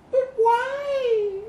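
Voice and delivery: high, whiny, lovelorn mock cry; mocking a lovelorn friend's gasp; mock gasping ninny. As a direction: whimper it like a lovesick fool. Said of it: "the lovelorn ninny gasps".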